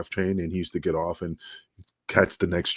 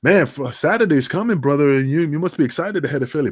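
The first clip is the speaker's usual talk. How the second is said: higher and louder, talking up the trip; hyped up; 7 semitones higher, 5 dB louder than usual. Retold or imitated. Urging the manager about Saturday in Philly, higher and louder. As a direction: push the pitch up, egging him on.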